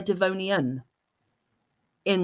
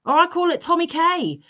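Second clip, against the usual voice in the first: playful slang; 10 semitones higher than usual. Real, not acted. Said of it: "That threw Panda for a loop".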